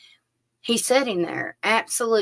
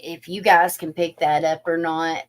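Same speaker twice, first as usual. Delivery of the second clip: ordinary talk, nothing marked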